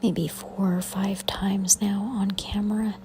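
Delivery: hushed whisper over the footage. Real, not acted. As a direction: keep it to a breathy whisper, barely voiced.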